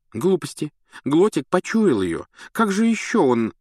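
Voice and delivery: dismissive retort. A narrator's reading. Hermione arguing back.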